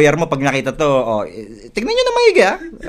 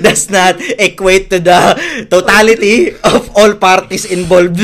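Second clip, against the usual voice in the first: loud, slow official announcement; mock disclaimer; 2 semitones higher, 8 dB louder, slower than usual. Disowning the talent's joke to viewers, mock-formal. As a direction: boom it out word by word in mock formality.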